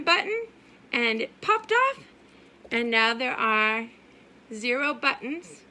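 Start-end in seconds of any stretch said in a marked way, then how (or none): none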